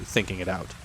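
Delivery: ordinary delivery, nothing marked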